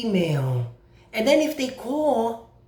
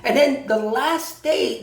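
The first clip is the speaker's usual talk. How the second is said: ordinary talk, nothing marked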